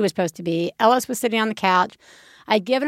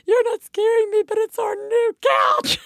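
put-on high, small voice of a worried little girl; child voice; 12 semitones higher than usual. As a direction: pipe up high like a small kid. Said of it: "She was like".

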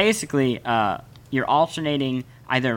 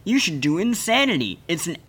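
higher-pitched hype ad voice, drawing out the last words; mock commercial pitchman; 4 semitones higher than usual. Imitating a workout ad pushing a harder program, mock pitchman. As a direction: imitate a pushy workout infomercial. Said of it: "And it's basically like".

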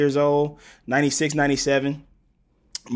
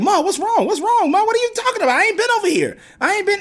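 high, loud protesting voice; an older cousin pleading innocence to her grandma; 4 dB louder, faster than usual. Retold or imitated. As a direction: squeak out a baffled denial. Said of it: "she's like".

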